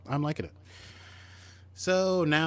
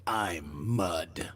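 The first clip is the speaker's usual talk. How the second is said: lower put-on Mudd voice; 6 semitones lower than usual. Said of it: "Rainn Wilson says".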